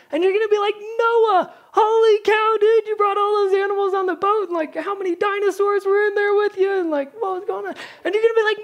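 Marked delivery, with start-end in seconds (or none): 0.8-7.8 high, excited rush of questions; fanboy amazed by the ark; starstruck gushing. Pretend. Gushing at Noah.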